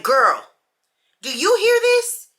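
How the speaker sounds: rally your friend; outraged call. Retold or imitated. Calling friend over in outrage.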